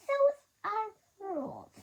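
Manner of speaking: high, loud, strict; stern librarian. A narrator's reading. Librarian insisting on the rules, stern and high. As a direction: lay down the rules firmly. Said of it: "stringy, stern voice".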